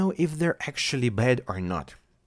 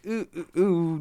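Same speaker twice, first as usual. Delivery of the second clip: cute cooing; 6 semitones higher than usual. Retold or imitated. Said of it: "doing the cute things".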